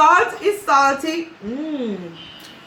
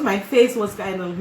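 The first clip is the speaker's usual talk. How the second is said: ordinary delivery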